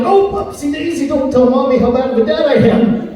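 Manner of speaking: put-on high, singsong baby talk to a toddler; dad cooing to a toddler who fell, high baby talk. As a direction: coo it up high like soothing a fallen kid. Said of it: "I'm like".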